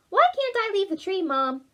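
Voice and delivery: put-on high child voice, protesting; young Oliver. Oliver asking Mom why he can't leave, high and pleading. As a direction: push back like a curious kid. Young owl whines to mother.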